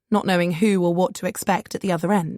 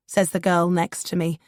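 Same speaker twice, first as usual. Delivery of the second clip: plain reading voice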